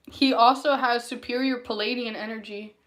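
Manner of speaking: ordinary delivery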